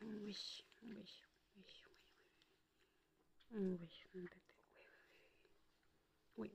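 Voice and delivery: breathy soft cooing lullaby; whispering over a crib. Real, not acted. Lulling pet to sleep. (murmure tendre pour endormir)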